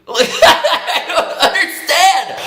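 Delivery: laughing. Real, not acted. Laughing while retelling the argument.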